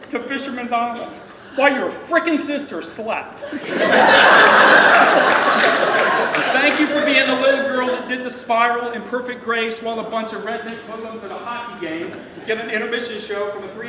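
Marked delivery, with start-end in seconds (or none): 1.6-12.1 laughing through the words; half-laughing, loud and warm. Real, not acted. Laughing during toast.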